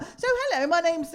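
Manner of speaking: high, sing-song posh greeting; posh hello. Pretend. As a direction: greet them like a grand lady. Comedian putting on posh greeting.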